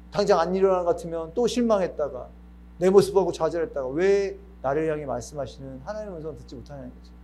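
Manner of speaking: usual speaking voice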